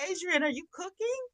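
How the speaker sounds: high, loud teasing question; coaxing a viewer to share lunch; playful call-out. Real, not acted. Calling out a viewer by name.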